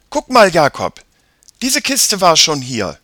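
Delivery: put-on high, loud child's call; excited girl. A narrator's reading. Sister shows Jakob the chest she found, excited. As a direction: call your brother over to look.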